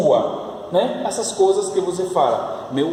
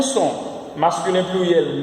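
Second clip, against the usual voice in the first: ordinary delivery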